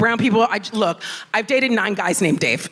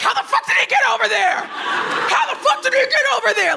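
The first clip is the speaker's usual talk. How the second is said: much higher, yelled alarm; panicked disbelief; 12 semitones higher than usual. Retold or imitated. Zoo animals seeing her hairy boyfriend, a panicked high yell. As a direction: shout it like a spooked zoo animal.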